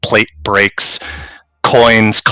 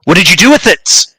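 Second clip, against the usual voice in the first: furious demand; 7 semitones higher than usual. Acted. Caspian interrogating the beaten father.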